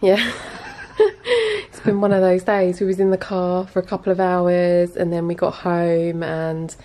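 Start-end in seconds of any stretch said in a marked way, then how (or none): 0.4-1.6 high giggle. Real, not acted. Giggling at partner.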